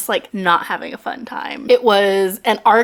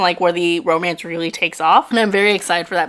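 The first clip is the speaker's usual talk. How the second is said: ordinary talk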